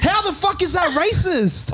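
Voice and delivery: high, slow mock Indian accent. [name] quotes S2 in a high mock Indian accent. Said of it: "You said".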